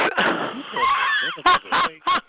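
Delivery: breathy squealing laugh far up high; shrieking high cackle. Real, not acted. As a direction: let out a gleeful squeal of a laugh. Laughs at her own joke.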